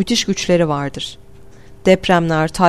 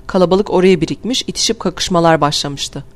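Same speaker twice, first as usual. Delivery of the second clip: ordinary delivery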